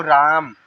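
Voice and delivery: राम with a long, open "aa"; wide-open long vowel. Shown as a demonstration. Teacher demonstrating the aa vowel sign, mouth wide open. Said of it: "बड़ा मुंह खुलेगा".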